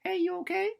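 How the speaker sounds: high, sweet motherly check-in; caring May. Retold or imitated. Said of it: "May comes".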